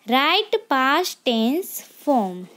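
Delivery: ordinary talk, nothing marked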